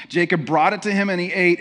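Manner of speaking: ordinary delivery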